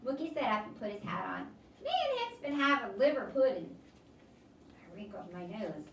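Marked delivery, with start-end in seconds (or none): 1.8-3.8 put-on louder folksy old man; a friendly drifter bragging; Southern drifter voice. Mookie speaks.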